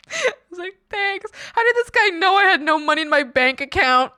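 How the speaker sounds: high, teary laughing voice; laugh-crying. Real, not acted. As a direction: choke up through laughter. Laughing retelling the gift.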